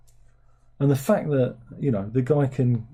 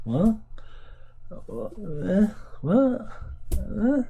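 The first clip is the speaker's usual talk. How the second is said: mock baffled what; 9 semitones higher, much slower than usual. Baffled by the monster version.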